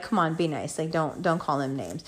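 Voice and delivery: low, soft retold plea; hushed placating. Speaker to Scorpio friend, quiet and low placating. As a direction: drop right down to calm a friend.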